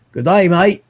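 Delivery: punchy Australian accent demo. Shown as a demonstration. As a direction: show off an amateur Down Under accent. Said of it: "never heard me do an accent".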